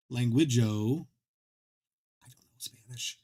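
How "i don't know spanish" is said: sudden unvoiced whisper; breathy throwaway whisper; whispered aside. Real, not acted. Muttered aside about not knowing Spanish.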